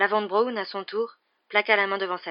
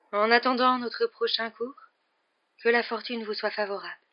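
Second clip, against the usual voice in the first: faint voice; 5 semitones higher, 4 dB louder than usual. A narrator's reading. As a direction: let the farewell sound a little weak and lingering. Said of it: "d'une voix faible".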